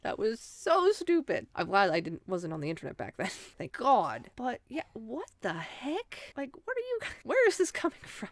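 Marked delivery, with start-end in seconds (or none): 0.0-1.4 cringing squeal. Real, not acted. Cringing at own past. 4.4-8.3 baffled. Real, not acted. Baffled by tweet.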